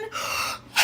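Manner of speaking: sharp excited gasp. Real, not acted. She gasps on seeing the bun, delighted gasp. Overjoyed at her new wig.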